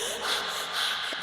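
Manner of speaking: a soft unvoiced panicked exhale; quiet breathless sigh of dread. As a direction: let out a shaky breath of panic. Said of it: "And I'm here just like".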